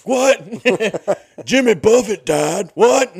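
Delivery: mock sandwich ad, hype man shouting What after every line; hype-man impression. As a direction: bark each item like a hype man. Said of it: "just gonna be impressions".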